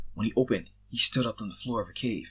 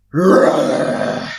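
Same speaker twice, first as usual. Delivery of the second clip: full-throated roar, higher and far louder; battle roar; 5 semitones higher, 16 dB louder than usual. A narrator's reading. Soldiers charging the wounded man, huge battle roar. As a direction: let out a huge war roar.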